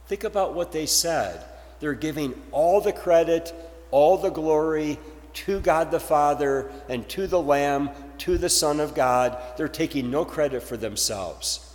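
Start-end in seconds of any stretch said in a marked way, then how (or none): none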